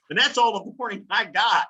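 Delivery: ordinary talk, nothing marked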